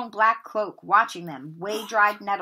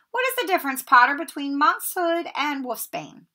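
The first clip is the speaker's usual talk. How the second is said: higher, mocking teacher's taunt; sneering Snape; 6 semitones higher, 4 dB louder than usual. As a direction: needle the boy with a sneer. Snape quizzes Harry.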